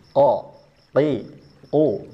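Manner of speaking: qaf dari pangkal lidah terangkat; contoh makhraj qaf. Shown as a demonstration. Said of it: "Contohnya begini". ("ko, ti, ku" diucapkan dengan pangkal lidah diangkat)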